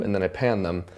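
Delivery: ordinary delivery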